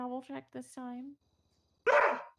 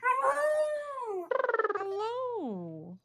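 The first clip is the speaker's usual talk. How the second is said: calling out; 12 semitones higher, 7 dB louder, much slower than usual. Real, not acted. Calling out a greeting.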